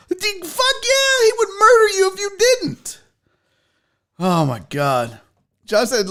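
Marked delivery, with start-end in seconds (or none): 0.1-3.0 high-pitched excited outburst; hyped, comic insistence; emphatic exclamation. Real, not acted. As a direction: blurt it out, voice shooting way up. Emphatic joking reply to a viewer.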